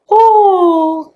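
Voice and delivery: long, high-pitched "oh" after laughing; high laughing sigh. Real, not acted. Storyteller winding down from laughing, high exasperated whoop.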